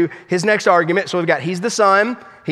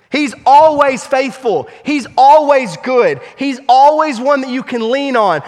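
loud, high, fervent preaching; preaching at full force; 6 semitones higher, 4 dB louder than usual. Acted. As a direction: lift the congregation with rising conviction. Preaching climax.

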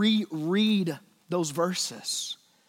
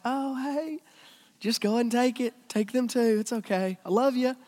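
mock timid dad; 7 semitones higher than usual. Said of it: "and your dad's like".

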